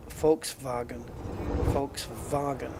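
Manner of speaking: "Volkswagen" with F and V, folks-vahgen; German pronunciation. Shown as a demonstration. Showing viewers the correct German "Volkswagen". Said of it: "that's the correct pronunciation".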